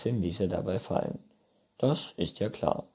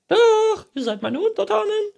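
very high, loud, rapid shriek; princess; 11 dB louder than usual. A narrator's reading. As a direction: screech it imperiously at your subjects. Princess figure commanding. (hohe, schnelle, laute Prinzessin)

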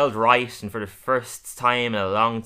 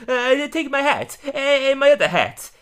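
high, slow chef put-on voice; Luigi's excuse; 6 dB louder than usual. Luigi apologizes to Fat Tony, high slow put-on voice. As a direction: fumble it like a nervous cook. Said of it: "He's like".

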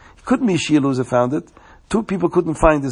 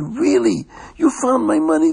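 high, thrilled and grateful; overjoyed to get his money back; excited man; 10 semitones higher than usual. Acted. Man thanks the finder for returning his money, excited and high. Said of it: "The man was so excited".

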